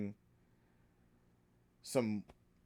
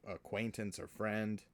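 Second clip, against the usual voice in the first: ordinary talk, nothing marked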